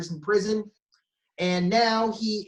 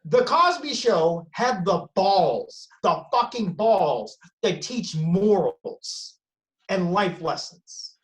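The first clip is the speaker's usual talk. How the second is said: slow emphatic; slower than usual. Real, not acted. Building outrage, slowed emphasis.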